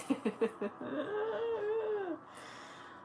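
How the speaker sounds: high teary laugh-cry; a sob that turns into a laugh; laughing through tears. Real, not acted. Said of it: "smiling and crying".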